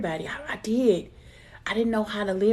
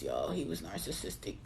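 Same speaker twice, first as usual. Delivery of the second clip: low aside; 13 semitones lower, 10 dB quieter than usual. Real, not acted. Aside about her ex.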